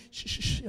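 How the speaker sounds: whispered shushing; hushing sound. Retold or imitated. Relatives quieting the crying children, whispered shushing.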